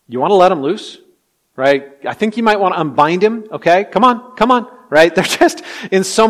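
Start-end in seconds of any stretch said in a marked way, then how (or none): 0.1-4.7 loud, climbing higher toward "come on"; incredulous, raised-voice urging; comic exasperated prodding. Acted. Voices Jesus prodding stunned onlookers.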